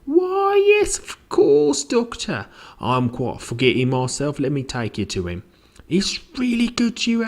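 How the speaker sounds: very high housekeeper, swooping down; fussing housekeeper. Acted. Housekeeper showing the doctor in, high and fussing. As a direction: start high and fluttery, gushing.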